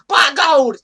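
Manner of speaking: loud, high excited "My God!"; commentator shout. Retold or imitated. Imitating a wrestling commentator's loud cry. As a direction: yell it like a ringside commentator. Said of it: "you had Michael Cole going".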